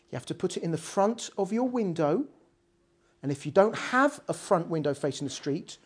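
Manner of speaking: a big rabbi quietly tells everyone what to do, soft. Retold or imitated. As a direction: speak the orders low and steady. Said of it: "in a quiet voice says".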